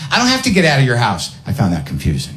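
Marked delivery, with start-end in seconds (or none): 0.1-1.3 fast, high snippy comeback; girlfriend's retort. Retold or imitated. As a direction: fire back like she won't budge. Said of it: "her lines".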